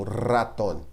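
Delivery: "ratón" with a trilled R; "ratón" with a tongue-trilled R; rolled R. Shown as a demonstration. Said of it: "essa tremida na língua aí".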